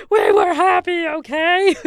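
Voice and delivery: hammed-up shouting old pastor; mock defensive shout. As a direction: yell it to shut down doubt. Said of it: "why you're shouting at me".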